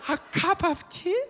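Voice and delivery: very high, sing-song exaggerated Chinese accent; high-pitched mock Chinese accent with slow pauses. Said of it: "lady just happens to say".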